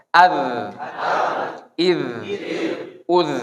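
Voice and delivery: "Az, Iz, Uz" with a buzzing z; za drill. Shown as a demonstration. Teacher leads students through the za drill. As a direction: let the z buzz at the end. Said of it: "kita ucapkan za".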